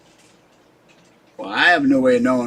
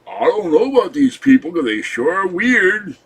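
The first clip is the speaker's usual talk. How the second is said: put-on character voice; 6 semitones higher, 5 dB louder than usual. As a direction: do a funny voice musing that people are weird. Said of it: "that voice is going in the mixture of things".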